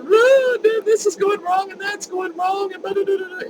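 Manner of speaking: high, loud, tumbling rant of troubles; retold emotional outpouring. Telling the stranger everything going wrong, high and loud. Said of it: "Niagara Falls of emotion".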